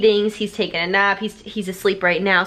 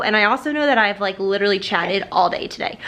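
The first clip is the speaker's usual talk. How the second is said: normal talking voice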